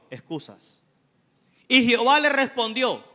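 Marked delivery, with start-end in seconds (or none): 1.7-3.0 loud, ringing pulpit delivery; preaching at full force. Acted. Preacher retelling Scripture to the congregation, loud and ringing.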